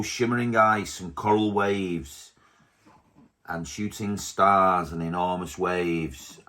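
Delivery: ordinary delivery, nothing marked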